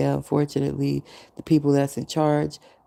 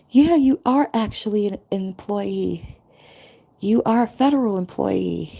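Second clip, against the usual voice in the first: nice official; 6 semitones higher than usual. Retold or imitated. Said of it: "they said it nicely".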